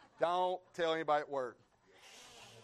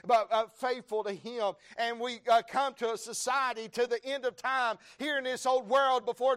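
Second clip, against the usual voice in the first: loud, high, driving revival preacher; thundering church proclamation, pitch climbing high; full-force preaching; 9 semitones higher, 10 dB louder than usual. Real, not acted. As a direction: build to a shout of praise, listing God's names.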